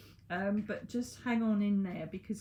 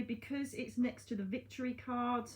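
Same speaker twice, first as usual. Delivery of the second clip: normal talking voice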